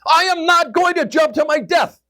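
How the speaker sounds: high, panicked shouted refusal; hysterical, high-pitched protest; voice of fear. Acted. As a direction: yell it in sheer terror. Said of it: "my voice for fear immediately responds".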